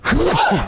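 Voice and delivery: sudden real sneeze; one sharp sneeze mid-sentence; sneezing. Real, not acted. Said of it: "Sorry, I'm gonna sneeze".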